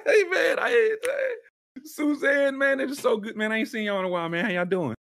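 high, eager shoe-salesman welcome; a gushing store clerk hamming up the hello; overfriendly salesman. Retold or imitated. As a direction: greet them like long-lost regulars, way up high. Salesman greeting the cult members.

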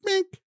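very high electronic bink; high beep. Retold or imitated. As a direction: chirp it like a thermometer beep. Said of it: "and it goes".

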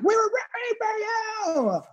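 high-pitched mock scream; screeched impression of a famous TV meltdown; iconic Tyra outburst. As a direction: yell it in a very high, drawn-out screech. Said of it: "we get the iconic".

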